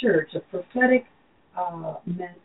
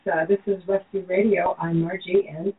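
ordinary talk

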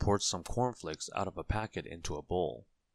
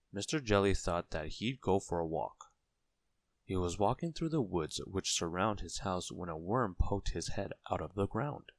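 plain reading voice

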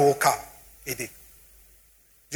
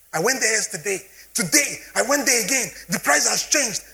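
wife's market complaint, voiced higher; mock the wife fretting over prices, pitched up; imitating wife complaining; 6 semitones higher than usual. Wife back from the market, griping about prices, higher mock voice. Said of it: "there are times in the house we'll say".

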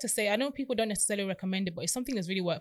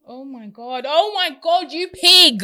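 high, loud disgusted shriek; shocked outburst; 7 semitones higher, 13 dB louder, slower than usual. Real, not acted. Friend hearing the story, shrieking in disgust. Friend reacts to creepy man.

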